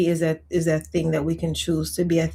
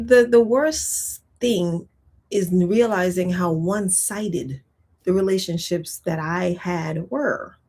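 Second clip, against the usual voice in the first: ordinary delivery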